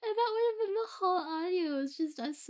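high-pitched, breaking up with laughter; laughing through words. Real, not acted. Confessing a dumb audio idea to listeners, laughing through words. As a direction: struggle to talk through the giggles. Cracking up at own silly idea.

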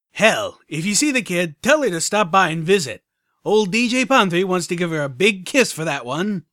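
loud, high radio DJ hype; DJ Pon3. Acted. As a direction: hype up the listeners like a radio host. Radio host voice.

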